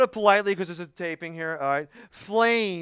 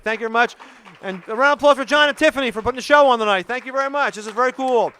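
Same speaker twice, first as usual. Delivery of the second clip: excited sign-off; 6 semitones higher, 7 dB louder, faster than usual. Real, not acted. Closing thanks to the crowd.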